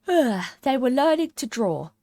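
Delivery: sleepy yawn through words. Acted. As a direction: talk mid-yawn, drowsy. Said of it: "yawning and rubbing its eyes".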